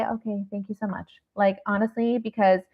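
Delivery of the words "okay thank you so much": much softer, placating mock thanks; polite brush-off. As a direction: drop to a quiet, dutiful thanks. Models polite answer to unwanted advice.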